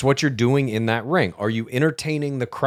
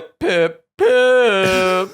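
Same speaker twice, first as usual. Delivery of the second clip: higher, louder half-sobbed "Pip, Pip, Pip"; crying catchphrase; 8 semitones higher, 6 dB louder, much slower than usual. Acted. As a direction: wail the catchphrase in mock despair. Crying catchphrase in sitcom pitch.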